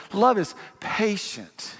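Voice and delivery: slow, sugary, drawn-out reading; dreamy, precious "Love is patient"; sweet and lovely. Shown as a demonstration. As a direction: savor each word like a greeting card. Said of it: "Let's just say it like this".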